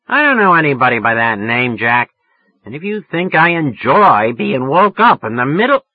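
irate tirade, loud and high; near yelling. A narrator's reading. Woman woken at night, angrily berating the caller. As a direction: let rip at the caller until cut off. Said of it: "She was damn near yelling at me".

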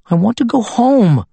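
put-on high, small child's voice, sulking; little girl voice. Granddaughter tells grandfather, in a sulky child voice. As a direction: play the six-year-old pouting.

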